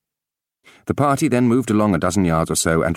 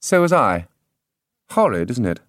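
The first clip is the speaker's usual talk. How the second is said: stepmother voice; 5 semitones higher than usual. A narrator's reading. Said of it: "she added".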